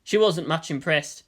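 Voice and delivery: ordinary delivery, nothing marked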